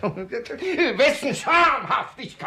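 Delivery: slow, high laughing disbelief; father laughing off the suitor's claim; incredulous laugh. Acted.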